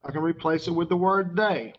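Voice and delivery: normal talking voice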